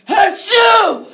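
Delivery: loud, high, explosive sneeze; huge sneeze. Acted. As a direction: let the sneeze blast out. Narrator's big sneeze.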